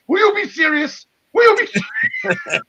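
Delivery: rising exasperated yell, peaking high; retold yell. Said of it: "Johnny Swinger yelling at Crazy Steve".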